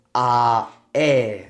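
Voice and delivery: long, separate "A" and "e"; pure Spanish vowels. Shown as a demonstration. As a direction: hold "A", then "e", without a glide. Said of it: "just like we said before".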